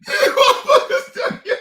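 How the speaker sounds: high, loud voice cracking up with laughter; helpless, squealing through real laughter; crying with laughter. Real, not acted. Reacting to angry people online, crying with laughter. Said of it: "Y'all done got me crying".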